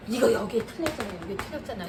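a quiet, blaming "틀렸잖아"; reproachful pointing out. Shown as a demonstration. Tutor showing mothers the wrong way to correct, mildly blaming. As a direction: point at the mistake with mild reproach. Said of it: "이렇게 해주시면 안 되고".

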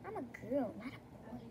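ordinary delivery

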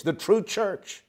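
ordinary delivery

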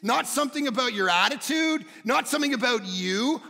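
loud, high-pitched sermon climax; full-force preaching. Real, not acted. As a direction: proclaim it with all your force.

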